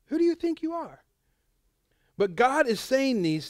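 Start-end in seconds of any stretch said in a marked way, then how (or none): 0.1-1.0 high, incredulous challenge; a sharp, high-pitched who do you think you are; indignant retort. Retold or imitated. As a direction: scoff at someone's arrogance. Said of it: "people would be like".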